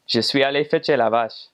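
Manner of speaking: "Je suis allé fetcher la vache" said clearly and evenly; the clean reference version; standard Quebec French. Shown as a demonstration. Normal speaker fetching the cow, in standard Quebec French. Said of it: "a normal person would say".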